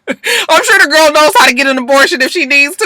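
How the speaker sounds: high, laughing through the words; laughing exasperation. Real, not acted. Vlogger laughing in exasperation at Bonnie. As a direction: laugh it off in disbelief. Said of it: "laughing exasperated reaction".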